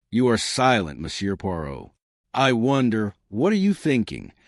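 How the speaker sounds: usual speaking voice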